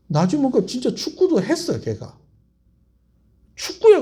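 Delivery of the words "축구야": high, loud teasing call; teacher's high teasing shout. Retold or imitated. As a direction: call the kid out across the classroom. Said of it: "그러더라고요".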